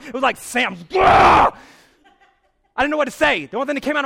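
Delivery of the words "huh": very loud, high roar of effort; hammed-up battle cry; explosive yell. Man lunging at the dude in the store, huge yell.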